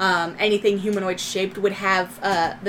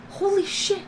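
stunned; 10 semitones higher, 4 dB quieter than usual. Acted. Player reaction voiced by GM.

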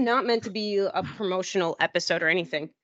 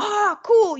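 cheerful reply; 12 semitones higher, 5 dB louder than usual. Retold or imitated. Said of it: "And they're like".

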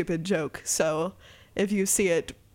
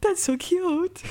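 higher, slow, melting coo; drawn-out cooing; 9 semitones higher, much slower than usual. Real, not acted. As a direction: stretch it out, totally charmed. Cooing at Kaz's clap.

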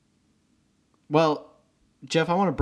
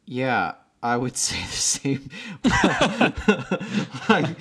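laughing; 4 semitones higher, 3 dB louder, slower than usual. Real, not acted. Real laughter heard.

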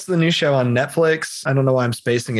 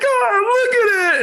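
mock crying actress; slower than usual.

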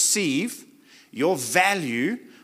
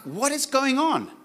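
retold bewilderment; 5 semitones higher than usual. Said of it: "And I'm like".

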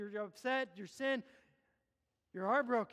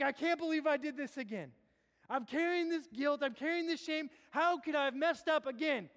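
louder, higher anguished inner voice; voicing a sinner's despair to the church; guilty sinner lament; 5 semitones higher, 5 dB louder than usual. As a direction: cry out the shame of failing again.

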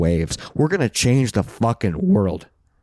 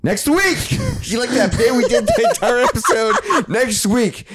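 much higher, laughing squeal; excited reveal; 13 semitones higher than usual. Real, not acted. Host revealing next week's theme, gleeful and laughing. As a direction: burst out gleefully.

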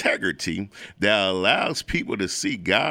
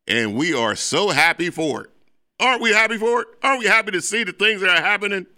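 excited; 5 semitones higher than usual. Real, not acted. Hyping March Madness basketball.

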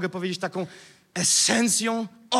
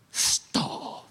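whispered, drawn-out stop; hushed command. Shown as a demonstration. Demonstrates calm command at stronghold.

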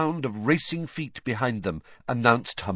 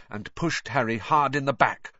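ordinary delivery

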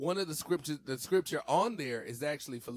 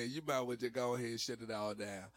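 ordinary talk

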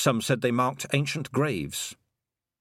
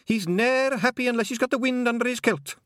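high Scottish pixie voice, louder; cheeky wee Scots pixie; 14 semitones higher, 3 dB louder than usual. A narrator's reading. Feegle pixie voiced in story.

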